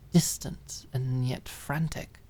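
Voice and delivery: usual speaking voice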